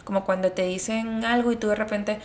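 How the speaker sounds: normal talking voice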